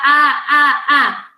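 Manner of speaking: put-on short loud child-like "ah" sounds; child attempting speech. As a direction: imitate a toddler trying to talk. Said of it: "já tá tentando falar".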